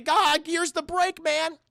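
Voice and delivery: high, slow straining cartoon slacker; Shaggy. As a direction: struggle through it in a high sidekick voice. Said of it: "But Shaggy, he's trying to".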